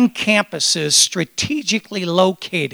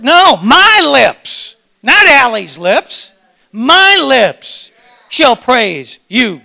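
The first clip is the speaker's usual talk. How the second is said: "my" stretched slowly and loud; stress on my; 2 semitones higher, 4 dB louder, much slower than usual. Shown as a demonstration. Pastor insists it is his own lips that praise. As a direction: lean on "my" in "my lips". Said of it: "stressing my lips".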